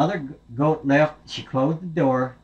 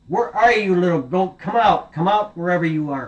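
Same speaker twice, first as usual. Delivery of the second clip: wolf calling out; 6 semitones higher, 5 dB louder than usual. Acted. Wolf hunting the last goat.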